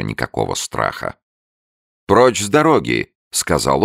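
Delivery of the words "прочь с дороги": higher, louder command; commanding shout. A narrator's reading. Abe orders the newt off, louder.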